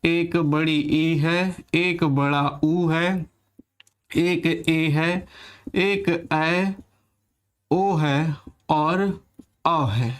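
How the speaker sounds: each vowel drawn out slowly; slow listing dictation. Shown as a demonstration. Teacher slowly listing vowels to the class. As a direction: spell out every vowel slowly for students. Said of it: "तो यहाँ पर देखिएगा".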